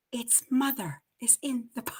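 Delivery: breathy whispered quote; whispered movie line. Shown as a demonstration. Whispering a Jaws line to the co-host, hushed. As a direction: hush it like a secret. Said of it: "whispered quote".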